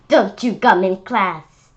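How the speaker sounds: loud stern rule; scolding teacher. Acted. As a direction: tell him off firmly. Teacher rule read aloud.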